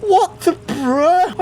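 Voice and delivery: high, loud, very slow baffled drawl. Real, not acted. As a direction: stretch out real confusion. Spinning wheels confuse him.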